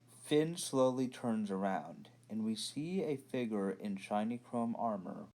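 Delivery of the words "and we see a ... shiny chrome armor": ordinary talk, nothing marked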